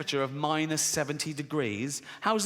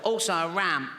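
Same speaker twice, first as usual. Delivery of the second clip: chesty, put-on child voice; funny little boy's voice. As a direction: add a demand as the poorly little lad. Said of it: "He's got a funny little voice".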